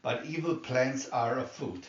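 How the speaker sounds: ordinary delivery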